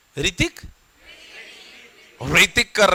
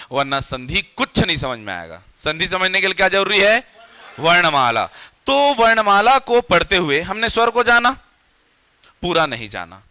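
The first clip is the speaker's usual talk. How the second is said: ordinary talk, nothing marked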